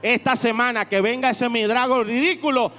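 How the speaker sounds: loud, rising preacher's call; preaching at full force. Acted. Pastor firing up the congregation, loud preaching. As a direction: drive the congregation with each question.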